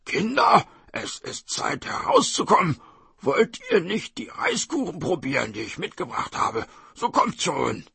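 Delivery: lightly voiced sly tiger calling out; tiger coaxing children.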